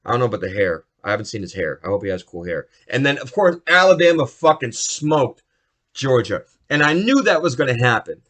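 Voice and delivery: usual speaking voice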